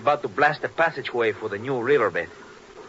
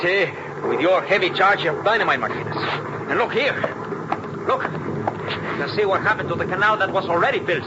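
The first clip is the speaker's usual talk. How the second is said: louder, higher, accusing; angry accusation; 7 semitones higher, 4 dB louder than usual. Acted. Inspector rounds on Martinez after the blast, angry and loud. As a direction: throw the blame at him hotly.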